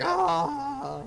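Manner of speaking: high shrieking mock yell; imitated scream. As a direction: let out a sudden high scream. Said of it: "Everyone's just like".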